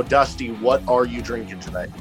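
ordinary talk

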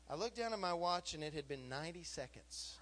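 usual speaking voice

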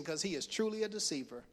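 ordinary delivery

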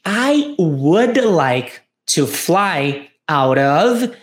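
slow, careful dialogue read; slow model phrase. Shown as a demonstration. Teacher modelling the passenger's answer slowly. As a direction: drag it out for learners to follow. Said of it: "ele diz o seguinte".

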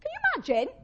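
high, loud, incredulous question; astonished, pitched right up; amazed exclamation. Real, not acted. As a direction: squeal it in amazement.

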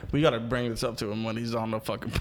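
ordinary delivery

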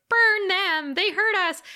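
acted high-pitched kid voice; little kids demanding. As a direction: call out like an accusing child. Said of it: "the little kids are like".

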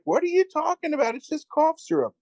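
very high mock-woman voice; indignant protest. Host as woman defending herself to accuser, indignant protest.

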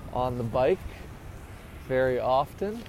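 usual speaking voice

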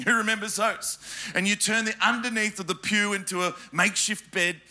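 ordinary talk

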